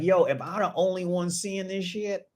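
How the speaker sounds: high, puzzled disbelief; incredulous. Retold or imitated. Gamer doubting his eyes, high puzzled question. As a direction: ask it like you're the only one seeing ghosts. Recalling the girl again.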